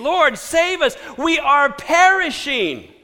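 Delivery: high, loud cry for rescue, slowing on the last words; desperate plea. Acted. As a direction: cry out to be saved, drawing out the end. Disciples waking Jesus in the storm.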